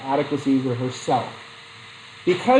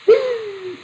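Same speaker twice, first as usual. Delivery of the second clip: high squeaky laugh. Real, not acted. Laughing at crying over movie.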